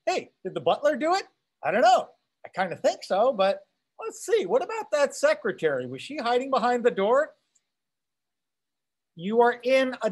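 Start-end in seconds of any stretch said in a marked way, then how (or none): 0.1-7.3 lightly acted wondering reader; little inner voice. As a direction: puzzle lightly over a whodunit, pitch jumping up. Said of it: "this little voice in your head that's saying".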